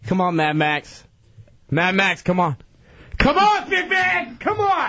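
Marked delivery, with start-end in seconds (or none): 3.2-4.9 high-pitched yelled challenge; yelling. Real, not acted. Calling out Mad Max over the car, yelling. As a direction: yell him out to fight. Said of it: "I just yelling".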